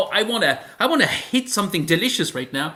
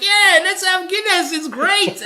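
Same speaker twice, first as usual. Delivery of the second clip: high, loud, slow mock tourist; hammed-up keen tourist ordering Guinness; eager French tourist; 13 semitones higher, 4 dB louder, slower than usual. A French tourist ordering Guinness, in a high, loud, hammed-up voice. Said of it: "you're like".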